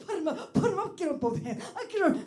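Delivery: high blustering lion challenge; Cowardly Lion. Pretend. Comedian doing the Cowardly Lion squaring up. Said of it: "Wizard of Oz".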